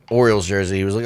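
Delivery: normal talking voice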